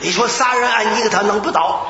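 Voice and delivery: usual speaking voice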